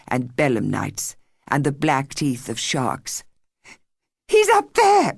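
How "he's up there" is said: high, loud, panicked cry; girl crying out that her brother is on the cliff; nearly hysterical cry. A narrator's reading. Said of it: "she cried, nearly hysterical".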